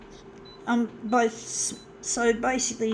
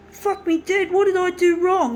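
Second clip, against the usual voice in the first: high, louder pleading cry; pleading with dad; 7 semitones higher, 5 dB louder than usual. Retold or imitated. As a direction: beg dad in distress. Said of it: "it's like".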